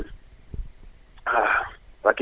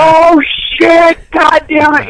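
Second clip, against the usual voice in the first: panicked yell; much slower than usual. Retold or imitated. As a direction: yelp it out when caught. Caught by brother, yelling.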